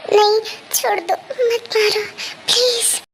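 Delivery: high-pitched frightened whimper; tearful pleading. Acted. Sleeping girl pleads not to be hit, tearful and scared. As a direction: beg through tears, still dreaming. Girl cries out in her nightmare.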